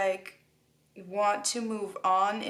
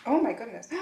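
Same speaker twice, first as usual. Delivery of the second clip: surprised; 3 semitones higher, faster than usual. Real, not acted. Reacting to a card reveal.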